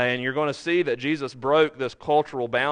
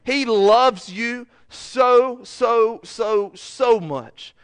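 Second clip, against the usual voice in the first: higher and louder, stretching each "so" out slowly; impassioned preaching; 7 semitones higher, 5 dB louder, much slower than usual. Real, not acted. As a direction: plead the words "He loves you so, so, so, so much" with rising emotion. Preacher pleading love of Jesus.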